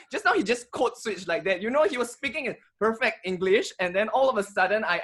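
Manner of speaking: ordinary talk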